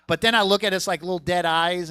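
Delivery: ordinary delivery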